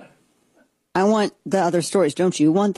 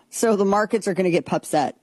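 ordinary talk, nothing marked

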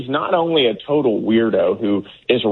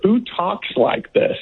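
higher, slow, drawn-out disbelief; who talks like this, mocking and slow; incredulous mockery; 7 semitones higher, slower than usual. Real, not acted. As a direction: stretch it out like it's absurd. Guest mocking candidate's phrasing.

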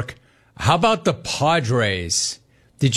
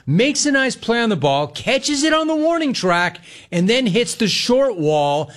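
rising high with excitement; excited; 8 semitones higher than usual. Acted. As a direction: get more and more hyped. Restated much higher, hyped.

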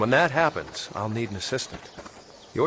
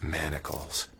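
low, hushed, grave; grave realisation; 9 semitones lower, 8 dB quieter, slower than usual. Acted. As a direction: say it quietly as the discovery sinks in. Shown slave chains, sombre realisation.

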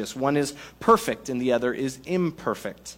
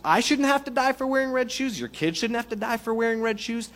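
high, incredulous objection; a fed-up friend pleading against the absurd rule, voice lifted; mock protest; 6 semitones higher than usual. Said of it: "'Cause you're gonna say".

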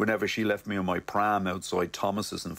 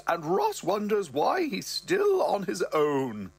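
slow, higher mother voice; mother's voice; 10 semitones higher, slower than usual. Retold or imitated. Mother teasing her son, slow higher voice. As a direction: drawl it out as the mum. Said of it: "and goes".

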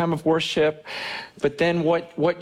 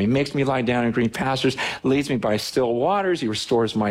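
fast, casual retelling of the verse; quick paraphrase; 4 semitones lower than usual. Shown as a demonstration. Pastor retells the psalm to show how it changes, quick and casual. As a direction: rattle it off conversationally. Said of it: "look at how this psalm changes".

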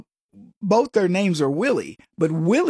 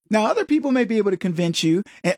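normal talking voice

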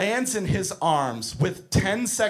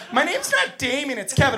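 higher-pitched mimicked child's protest; kid's reply; 8 semitones higher than usual. Kid corrects the coach who just yelled at him, higher kid voice. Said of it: "And he's like".

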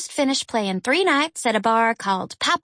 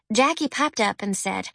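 usual speaking voice

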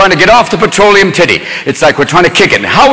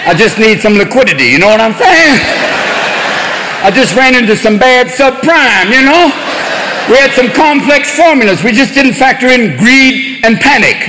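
wheedling junkie, pitched up; relapsed junkie; 4 semitones higher than usual. Retold or imitated. Banker voiced as junkie, pleading for cash. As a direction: beg like a hustler needing a fix. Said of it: "junkies who've relapsed and are going".

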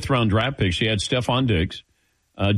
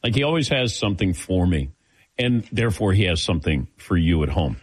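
ordinary delivery

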